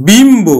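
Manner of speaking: বিম্ব said firmly, the ম্ব cluster clear; pronounced example. Shown as a demonstration. Teacher giving the class বিম্ব clearly. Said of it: "আবার দেখো".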